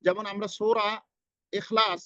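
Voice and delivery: ordinary talk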